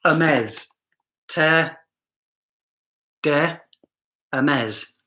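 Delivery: "Hermes" with silent H, "Terre de" word by word; careful, spaced-out "Terre de Hermes", no H; corrected French pronunciation. Shown as a demonstration. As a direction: practise the French "Hermes" as er-MEZ, slowly and separately. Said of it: "I've already been corrected".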